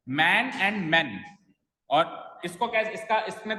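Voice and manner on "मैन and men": very slow, "मैन" long against "men" short; vowel contrast. Shown as a demonstration. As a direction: stretch "मैन", then a closer vowel on "men". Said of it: "pronunciation में थोड़ा सा difference आ ही जाता है". (धीरे-धीरे "मैन" और "men" का फ़र्क दिखाते हुए)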